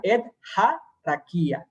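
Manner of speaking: slight stress on "ha", then a tiny pause before "rakia"; acento en "ha". Shown as a demonstration. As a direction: lean briefly on "ha" and brake before "rakia". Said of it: "Hace un pequeño acento en ja". (pequeño acento y freno en "ha")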